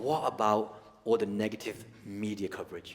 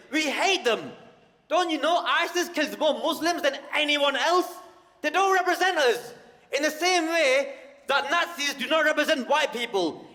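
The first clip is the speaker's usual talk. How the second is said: much higher, loud furious tirade; outraged rant; 7 dB louder than usual. Retold or imitated. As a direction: explode at the man, all guns blazing. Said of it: "At that moment I lost it. I stood up and went".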